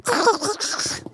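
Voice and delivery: high, odd mock laugh; imitating Emily's laugh. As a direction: do a silly impression of someone's laugh. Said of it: "Emily's laugh".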